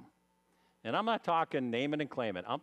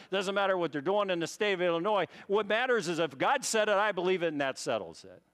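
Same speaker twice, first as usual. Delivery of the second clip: rising, louder preacher's litany; preaching at full force; 6 semitones higher, 4 dB louder than usual. Acted. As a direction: build the list up like a sermon. Building preaching run.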